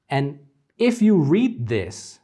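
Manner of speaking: ordinary talk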